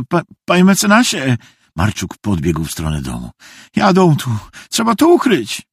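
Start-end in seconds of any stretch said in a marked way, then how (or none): 0.1-1.4 high, stuttering appeal; panicked stammer. Acted. Panicked boy appeals to the lawyer. 3.8-5.6 put-on high, urgent boy's warning; alarmed warning. Boy warns of approaching gendarmes.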